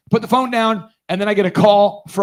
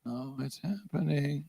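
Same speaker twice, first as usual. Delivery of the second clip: inner thought; 5 semitones lower, 14 dB quieter, slower than usual. Retold or imitated. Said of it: "I'm thinking".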